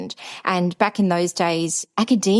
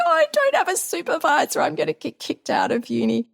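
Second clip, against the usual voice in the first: tearful; 10 semitones higher than usual. Retold or imitated. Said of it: "I was crying".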